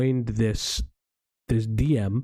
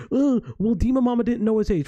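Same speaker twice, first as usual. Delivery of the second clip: imagined excuse; 9 semitones higher than usual. Retold or imitated. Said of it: "even if you're gonna say".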